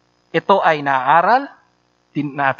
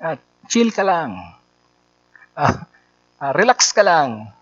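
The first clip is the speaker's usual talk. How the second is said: soothing inner voice; 3 semitones lower than usual. Acted. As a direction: gently settle someone down. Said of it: "may humahawak sa'yo at sinasabing".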